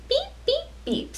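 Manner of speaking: high, short horn beeps; truck horn beeps. Acted. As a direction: beep like a little truck. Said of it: "says the Little Blue Truck".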